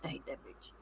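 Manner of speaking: imitating a scrolling viewer's snide whisper; muttered under breath. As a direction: hiss it to yourself while scrolling. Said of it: "your face scrunches up like".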